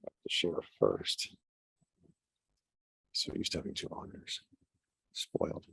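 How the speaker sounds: unvoiced mutter under his breath; muttered aside. Real, not acted. Presenter muttering to himself while setting up the screen share. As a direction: mutter it to yourself, barely audible.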